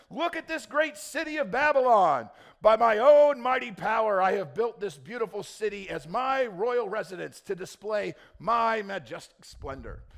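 booming, arrogant king proclaiming from the rooftop; loud, swaggering royal proclamation; grand royal boast. Acted. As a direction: belt it out like a proud king admiring his city. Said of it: "if I didn't read that with enough emphasis on the right syllables".

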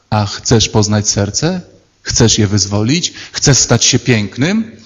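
gentle question. Retold or imitated. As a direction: ask tenderly, inviting. Jesus asks gently. (łagodne, powolne pytanie Jezusa)

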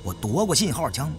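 usual speaking voice

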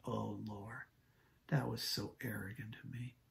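very soft, low confession; hushed prayer. Retold or imitated. Pastor confesses his pride to God, in a hushed murmur. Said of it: "I thought".

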